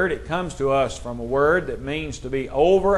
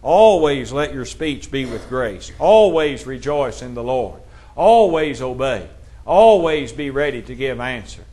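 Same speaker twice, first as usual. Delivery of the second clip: each line opens with "always" leaned on, falling; preacher stressing "always" in each verse. Shown as a demonstration. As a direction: hammer "always" at the start of every line. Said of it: "we emphasize the word always".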